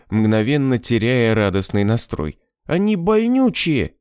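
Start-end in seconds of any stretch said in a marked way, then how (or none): 2.7-4.0 put-on high complaining little boy; whiny kid protesting; child voice. A narrator's reading. Boy complains about shots.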